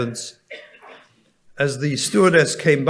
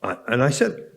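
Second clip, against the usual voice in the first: ordinary talk, nothing marked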